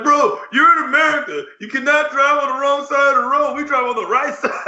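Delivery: high-pitched mock yelling; imitated screaming. Wrestler scolding his partner's driving, mock screaming. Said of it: "he was screaming at him".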